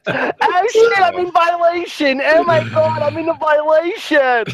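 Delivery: high-pitched hammed-up alarm; mock alarm. Host catching himself breaking forum rules, mock alarm.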